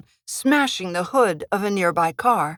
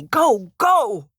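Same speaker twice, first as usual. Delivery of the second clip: louder, rising urgent go go; urgent shout; 5 semitones higher, 4 dB louder than usual. Acted. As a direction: push him past you with your voice. Waving Buzz ahead urgently.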